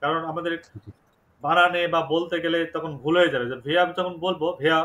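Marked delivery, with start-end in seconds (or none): none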